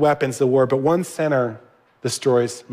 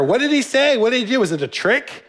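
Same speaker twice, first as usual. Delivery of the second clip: eager questions; 8 semitones higher than usual. Real, not acted. Preacher begging Solomon for details, excited high questions. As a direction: pitch leaps up high on each eager question.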